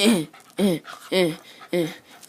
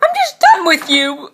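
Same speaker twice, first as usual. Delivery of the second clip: higher, mock-fed-up line; high playful exasperation; exasperated; 9 semitones higher, 3 dB louder than usual. Real, not acted. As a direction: throw up your hands. Exasperated at the dog.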